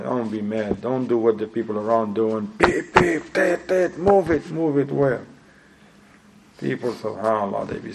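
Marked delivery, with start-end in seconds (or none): 2.6-5.2 high mimicked car horns and demands; mock traffic-jam impatience, higher voice; impatient drivers. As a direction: imitate impatient honking and hollering, pitched up.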